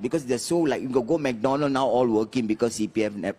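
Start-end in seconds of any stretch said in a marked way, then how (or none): none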